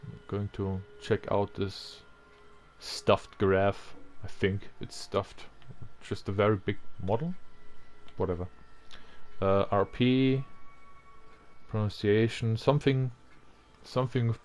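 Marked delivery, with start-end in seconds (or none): none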